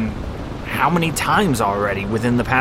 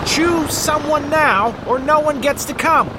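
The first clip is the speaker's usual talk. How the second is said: shouting over machinery; 14 semitones higher than usual. A narrator's reading. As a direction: demand it over rotor noise. Co-pilot demands over helicopter noise.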